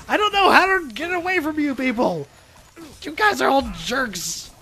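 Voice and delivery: frustrated yell. Real, not acted. Swarmed by enemies, yelling.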